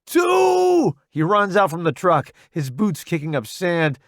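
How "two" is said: high, loud, drawn-out holler; shouted count. A narrator's reading. Roddy bolting early from the truck, shouted count. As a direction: yell it as you take off. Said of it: "he shouts".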